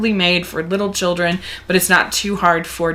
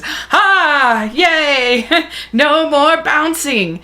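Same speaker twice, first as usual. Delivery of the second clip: high, drawn-out gleeful crowing; hammed-up celebration with a laugh; mock jubilant cheer; 8 semitones higher, much slower than usual. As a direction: crow in triumph as a cartoon rabbit. Acting out Rabbit's celebration.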